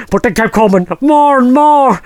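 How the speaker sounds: normal talking voice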